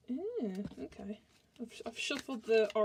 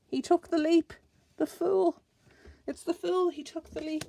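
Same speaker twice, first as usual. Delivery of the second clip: higher and louder, delighted at the card; excited; 6 semitones higher, 6 dB louder than usual. Real, not acted. Reader to viewers as the Fool card leaps, excited. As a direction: exclaim as the card flies out.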